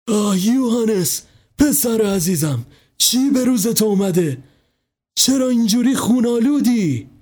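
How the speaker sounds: a mother shocked at her son's wounds; horrified cry. A narrator's reading. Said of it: "با لکنت فریاد کشید".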